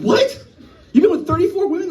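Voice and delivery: stunned coworker can't believe the number; shocked disbelief. Retold or imitated. A coworker reacting to his answer, high shocked disbelief. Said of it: "They're like".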